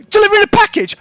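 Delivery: very high, loud, incredulous retort; outraged echo. Acted. Shopkeeper repeating the driver's excuse back at him, outraged. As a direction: throw it back, pitched right up and loud.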